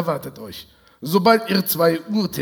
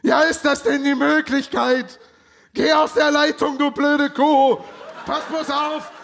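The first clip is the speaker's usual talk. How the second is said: higher, furious ranting; furious yelling; 7 semitones higher than usual. Acted. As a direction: rant at her in outrage. Kommissar tobt am Telefon. (wütendes, höheres Schimpfen)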